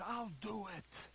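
very soft, slow promise; hushed and unhurried, as God replying; slow, quiet reassurance; God's quiet answer. Acted. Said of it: "God said".

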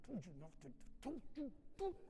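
sputtering, choked mock scolding; comic parent losing it, words breaking up; speechless angry parent. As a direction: splutter as if too mad to talk. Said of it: "they can't talk anymore".

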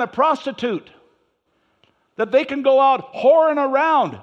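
loud, high preaching to the congregation; full-force preaching. Acted.

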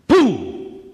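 loud sudden boom. Retold or imitated. Pastor showing anger flaring up, loud boom. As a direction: burst out like a flame exploding. Flame bursting when fuel added.

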